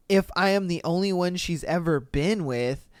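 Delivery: ordinary delivery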